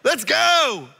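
loud, high whoop of joy; excited cheer. Retold or imitated. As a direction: cheer like the trip just began. Excited start of road trip.

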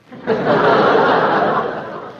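breathy amused laugh; a polite laugh. Acted. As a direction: laugh at the boy's joke. Wife laughs at grocery boy.

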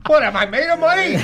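hammed-up outraged question through a laugh; mock indignation. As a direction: play the cheapskate, outraged and laughing. Mock outrage joke about price.

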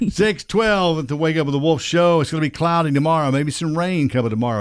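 upbeat radio host intro. Acted. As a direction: punch it like a morning DJ.

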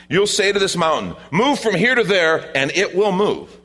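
high, loud, emphatic scripture declaration; forceful proclamation. Acted. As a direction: proclaim it with rising fervour. Preacher proclaims climax of verse.

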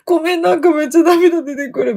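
high, teary mock sob in the voice; replaying her own tipsy tears; retold tearful apology. Telling her sister she can't stop crying, high and teary. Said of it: "で、泣いたの、".

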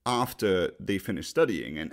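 ordinary delivery

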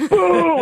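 high, loud mock boo; imitated crowd boo. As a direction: boo him down like an angry crowd. Crowd booing him again.

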